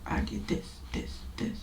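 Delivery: quick whispered confession; hushed blurting. Retold or imitated. Speaker confessing everything to God in a whisper. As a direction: spill it under your breath. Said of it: "I just blurted it all out".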